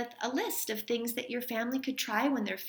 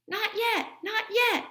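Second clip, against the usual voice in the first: much higher playful call; 12 semitones higher, 5 dB louder than usual. Acted. Henry and father stopping mother on the stairs, high teasing call. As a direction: hold her off with a bright teasing call. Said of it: "they called".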